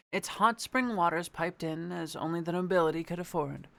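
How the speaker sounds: ordinary delivery, nothing marked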